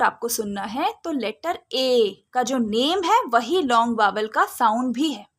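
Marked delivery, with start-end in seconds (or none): none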